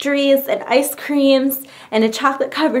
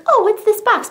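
high, light puzzled question; acted curiosity; 10 semitones higher than usual. Opening a forgotten package, acted high curiosity. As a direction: wonder aloud in a higher voice.

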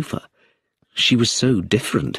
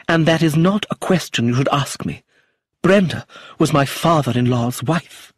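high, stagey indignation; theatrical; 7 semitones higher than usual. A narrator's reading. Actress rebuking the inspector, theatrical indignation. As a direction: deliver it to the back row. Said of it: "stood up theatrically as if she was on stage".

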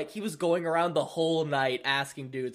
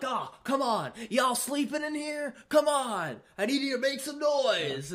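higher, pushy hype-man voice begging for noise; mock a rapper pleading with a dead crowd; 8 semitones higher than usual. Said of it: "They're like".

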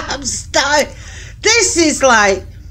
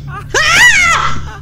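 short high shriek; sudden real scream of fright; startled scream; 12 semitones higher than usual. Real, not acted. Player hit by a jump scare, startled scream.

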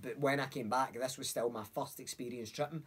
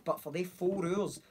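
ordinary talk

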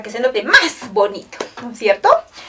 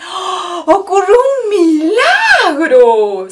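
slow, high, loud and tearful with wonder; awed announcement; 6 semitones higher, 6 dB louder, much slower than usual. A narrator's reading. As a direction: reveal the miracle in awe. Announcing the miracle. (asombro lento y emocionado)

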